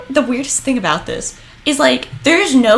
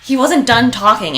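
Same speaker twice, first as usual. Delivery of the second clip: ordinary talk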